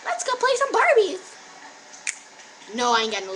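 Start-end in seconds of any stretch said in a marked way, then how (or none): 0.1-1.2 put-on very high, loud little-girl voice. Imitating a little girl wanting to play Barbies, high mock-child voice. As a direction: pipe it up high like an excited child. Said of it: "no little age girl talking about".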